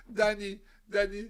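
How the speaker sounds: slow, high laughing fake sob; mock crying plea. Cellmate begging how to forget, mock sobbing whine. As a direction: whimper it, mocking the crier.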